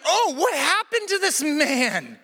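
high-pitched, drawn-out dismay; acted high-pitched alarm; horrified disbelief. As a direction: react aghast to a shocking noise. Reacting in horror to the shriek.

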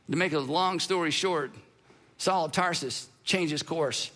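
usual speaking voice

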